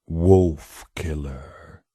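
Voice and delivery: very slow, low creature voice; eerie drawn-out whisper; long low whisper. A narrator's reading. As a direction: drag the name out like a summons. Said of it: "came the voice again".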